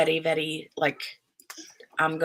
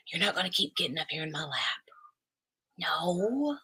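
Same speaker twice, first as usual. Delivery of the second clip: whispered aside; 7 dB quieter than usual. Real, not acted. Mother whispers to the child in her lap, quick. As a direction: keep it off mic, just for her.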